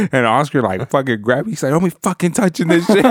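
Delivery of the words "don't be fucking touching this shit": mock scolding. Uncle grabbing him, scolding.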